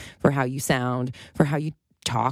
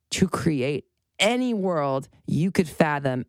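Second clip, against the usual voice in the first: normal talking voice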